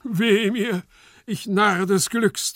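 loud, high theatrical cry; high wail, stretched at the end; raving. Acted. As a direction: rave in melodramatic despair. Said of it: "tobte noch immer Gumpellino".

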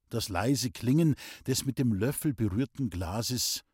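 plain reading voice

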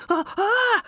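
high, loud panicked yelps; panicked scream. Acted. Rufus walks into a spider web, panicked high yelps. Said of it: "schrie er auf".

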